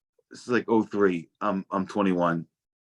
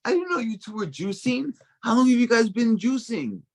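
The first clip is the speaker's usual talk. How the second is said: much higher, sing-song eccentric woman; mom's quirky friend gushing in the juice line, high and sing-song; eccentric lady; 13 semitones higher than usual. Retold or imitated. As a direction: play the nosy, eccentric family friend. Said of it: "And she's like".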